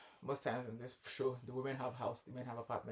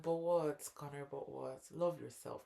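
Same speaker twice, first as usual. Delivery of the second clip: mock pep talk, higher; pleading scolding; 5 semitones higher, 6 dB louder, slower than usual. Friend urging Connor to stand up, pleading. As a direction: urge him to love himself, slow on please. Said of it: "I'm like".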